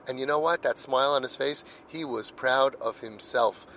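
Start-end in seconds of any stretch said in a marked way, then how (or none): none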